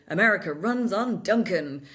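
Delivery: punchy mock-American ad slogan; American accent. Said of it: "have to say them in American accent".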